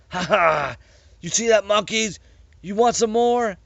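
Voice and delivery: high, loud taunting cackle; gloating laugh. A narrator's reading. Captain taunting the monkeys behind the door, loud and high. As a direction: crow over the enemy. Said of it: "The captain laughed".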